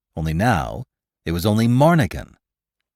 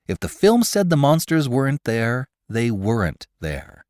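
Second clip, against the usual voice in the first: usual speaking voice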